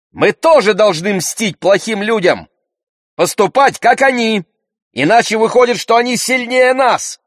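high, loud, heated argument; boiling over. A narrator's reading. Brother demands revenge on bad people, boiling over. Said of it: "вскипел Андрей".

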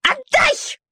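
very high piercing squeal; shrieking little dog. A narrator's reading. Shaiba shrieking at the trapped puppy, piercing squeal. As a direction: shriek it like a cornered lapdog. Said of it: "снова завизжала Шайба".

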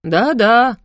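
loud, gleeful yes-yes; eager agreement. A narrator's reading. Tattling cat eagerly confirms to parents, gleeful. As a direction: pile it on with relish.

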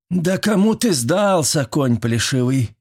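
high, drawn-out scornful woman's voice; cross old woman putting him down; angry woman. A narrator's reading. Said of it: "раздался сердитый незнакомый женский голос".

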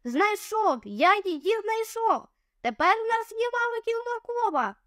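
high, excited cry of discovery; excited shout. A narrator's reading. Boy shouting to his friends about the map, excited. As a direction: shout it out, thrilled at the find. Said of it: "закричав".

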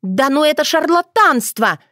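high, worked-up protest; unappeased indignation. A narrator's reading. Dunya getting her voice back, still indignant. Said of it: "не успокаивалась та".

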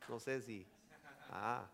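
ordinary talk, nothing marked

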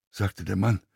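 ordinary delivery

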